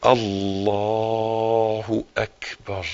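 very slow, soft, stretched Allahu akbar; drawn-out takbir. Shown as a demonstration. Preacher demonstrating the opening takbir, slow and stretched. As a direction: stretch الله اكبر out long and low. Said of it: "شوف الايدين".

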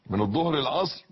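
ordinary delivery

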